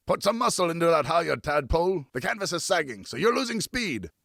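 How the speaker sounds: put-on a salty riverman coaching a boy at the sail; riverman giving orders. A narrator's reading. Riverman instructing the boy at the sail, gruff and commanding. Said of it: "instructed Francois".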